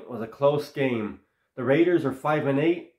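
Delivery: normal talking voice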